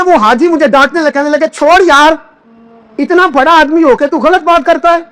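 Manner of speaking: high, ringing sermon voice building up; impassioned preacher; preaching at full force. Acted. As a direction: preach it out, climbing with each line. Climactic retelling, rising preaching.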